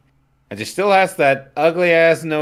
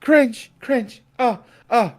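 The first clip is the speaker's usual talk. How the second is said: high-pitched; 10 semitones higher, slower than usual. Real, not acted. Reacting to cringe dialogue.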